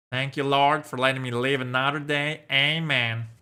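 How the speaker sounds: put-on a light southern accent on a grateful prayer. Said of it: "The southern accent".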